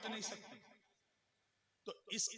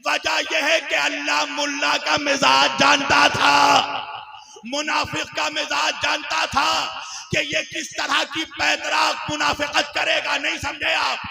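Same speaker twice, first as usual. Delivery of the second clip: loud, high, sustained sermon delivery; full-force preaching; 7 semitones higher, 20 dB louder than usual. Acted. Preacher arguing to the congregation why both were said, full-force preaching. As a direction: proclaim the argument, building to a shout.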